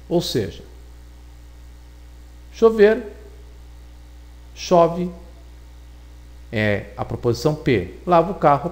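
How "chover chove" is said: normal talking voice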